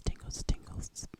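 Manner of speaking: drawn-out whispered tingles; ASMR whisper. Real, not acted. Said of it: "working on my A S M R voice".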